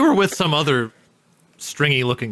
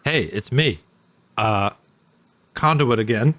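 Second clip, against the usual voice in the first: sultry voice; 4 semitones lower than usual. Pretend. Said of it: "Ooh, it's that sultry voice".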